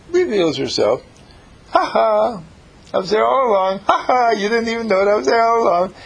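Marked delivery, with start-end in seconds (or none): 1.7-5.9 triumphant gloat. Acted. The revealed Sylvia triumphantly crowing, high gleeful voice. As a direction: crow it like a hidden self unmasked.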